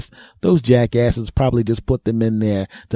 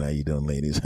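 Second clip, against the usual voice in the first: casual suave greeting; 7 semitones lower, 9 dB quieter than usual. Retold or imitated. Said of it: "not to sound like I'm out of breath".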